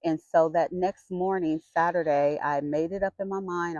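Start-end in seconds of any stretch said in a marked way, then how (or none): none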